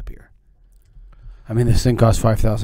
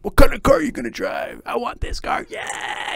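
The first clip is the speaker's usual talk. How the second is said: high, loud, fast hype shout; pumped-up sales boss; 14 semitones higher, 3 dB louder than usual. Retold or imitated. Sales manager pumping up the morning meeting, high and fast. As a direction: fire up the room like a hyped motivator.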